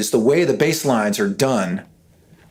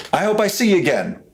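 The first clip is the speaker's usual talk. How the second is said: [name] praise; 8 semitones higher, faster than usual. Retold or imitated. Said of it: "He's like".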